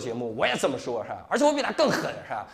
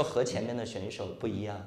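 mock tearful contestant; 8 semitones lower than usual. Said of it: "她说".

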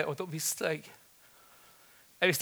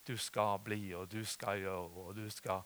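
low, slow, soft recited pronouncements; imitated prophesying; 6 semitones lower, 8 dB quieter, slower than usual. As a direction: lightly mimic someone prophesying over each person. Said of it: "så hørte jeg det ble profetert".

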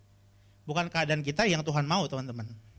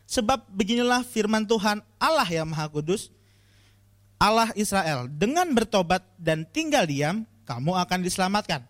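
louder, solemn Bible reading; scripture reading; 3 semitones higher, 6 dB louder than usual. Real, not acted. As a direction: proclaim the verse. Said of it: "gue bacain".

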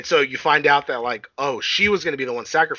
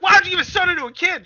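high, fast accusing shout; 6 dB louder, faster than usual. Retold or imitated. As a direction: yell it at them in panic. Mom confronting in retold scene.

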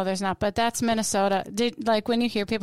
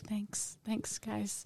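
softly retold muted thanks; 2 semitones lower, 15 dB quieter, much slower than usual. Guest retelling her reply to being called funny, muted thanks. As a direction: say the thanks much quieter, almost to yourself.

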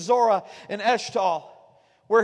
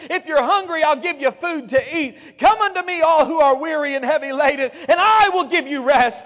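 higher, louder, building sermon climax; full-force preaching; 4 semitones higher, 5 dB louder than usual. Acted. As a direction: preach it out, rising to a shout.

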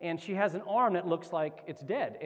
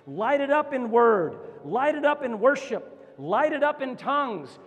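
high, loud, rallying exhortation; impassioned preacher's crescendo; full-force preaching; 4 semitones higher, 4 dB louder than usual. Real, not acted. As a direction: drive each phrase home like a rally cry.

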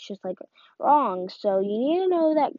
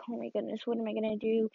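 hushed panic; 7 dB quieter, faster than usual. Pretend. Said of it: "you're like".

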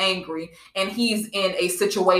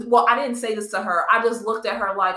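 usual speaking voice

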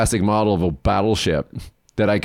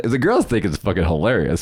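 ordinary talk, nothing marked

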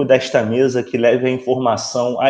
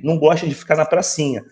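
ordinary delivery

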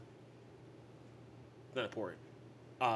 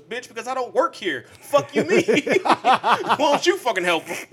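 much higher and louder, cracking up mid-quote; laughing mock yell; 12 semitones higher, 16 dB louder, slower than usual. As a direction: yell it while laughing at the guy. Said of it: "The guy filming goes".

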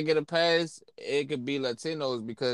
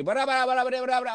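high, rapid babble imitating Spanish speakers; mimicking fast Spanish chatter; mock Spanish gibberish; 10 semitones higher, much faster than usual. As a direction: rattle off fake Spanish, pitched up.

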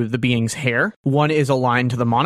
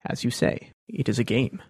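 smiling Thrawn deduction. Acted. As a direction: purr through the deduction, calm and knowing. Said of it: "he smiles a little bit ... and says".

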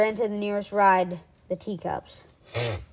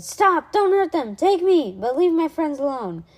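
high, loud plea to a monster; desperate shout; 7 semitones higher, 5 dB louder than usual. A narrator's reading. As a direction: shout to save your friends, easing on the last words. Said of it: "Alexander popped up and shouted".

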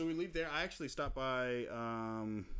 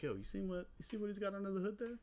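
hyped friend; 10 semitones higher than usual. Retold or imitated. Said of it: "he's like".

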